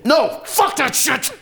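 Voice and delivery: sudden furious refusal to break down; angry outburst. Acted. Man choking up over his brother cuts himself off, angry outburst. Fights back choked-up emotion.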